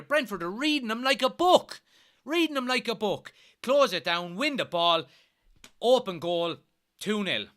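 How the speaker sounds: loud, high furious shouting; angry rant. Real, not acted. Fan reliving the conceded goal to viewers, loud and angry. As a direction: bellow it out in frustration.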